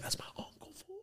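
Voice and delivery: proud whispered brag; imitating a whisper. Bragging as a kid.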